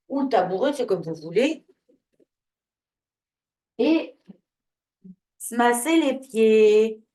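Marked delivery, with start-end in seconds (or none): 3.8-7.0 loud, drawn-out announcement to the group; calling out. Real, not acted. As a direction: stretch the words out loudly to rally everyone. Instructor announces the exercise to the group.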